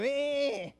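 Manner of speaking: short, high whining refusal; imitated toddler whine. Child rejecting the parent's guess, mock whine. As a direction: mimic a small child's wordless whine. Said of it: "whiny cry".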